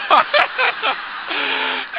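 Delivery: a burst of high breathy laughter; sudden unvoiced laugh that takes over; big real laugh. Real, not acted. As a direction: laugh out hard, almost silent. Laughs at neighbor's joke.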